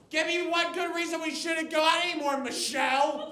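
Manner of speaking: high-pitched mock desperate plea; pleading boyfriend. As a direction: beg in a high voice, imitating a dumped boyfriend. Overheard man pleading during breakup.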